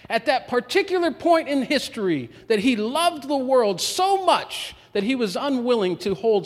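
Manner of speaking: preaching at full force. Acted. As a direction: climb into a full-throated sermon peak. Preacher climaxes on God's love.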